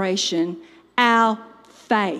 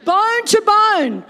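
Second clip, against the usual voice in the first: loud, high, drawn-out declaration; dramatic proclamation; 9 semitones higher, 7 dB louder, slower than usual. A narrator's reading. Preacher announces bones joining after effect, loudly dramatic. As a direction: belt it out slowly and grandly.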